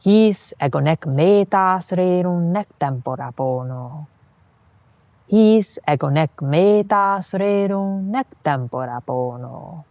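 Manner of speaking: whole verse chanted in hexameter; poem recitation. Shown as a demonstration. Teacher reciting full verse in meter, chanting. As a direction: recite it like an ancient poet. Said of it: "Listen to it first".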